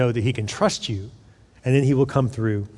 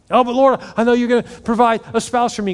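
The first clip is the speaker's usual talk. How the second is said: high, whiny begging prayer voice; mock a self-centred pray-er, pitched up; hammed-up begging to the Lord; pleading believer; 8 semitones higher than usual. Congregant's self-focused prayer imitated.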